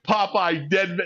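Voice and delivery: usual speaking voice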